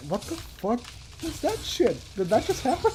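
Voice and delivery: hushed, high-pitched disbelief; mild retold panic, high and soft; shaken on waking. Dreamer waking from being stabbed, shaken.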